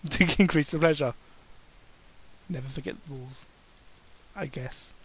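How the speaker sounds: usual speaking voice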